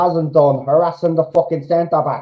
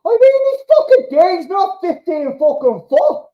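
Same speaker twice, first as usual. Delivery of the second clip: high, strained outburst; exasperated shout; 12 semitones higher than usual. Real, not acted. Fan venting at a missed header, high exasperated shout. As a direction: vent at the missed chance.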